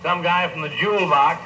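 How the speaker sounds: usual speaking voice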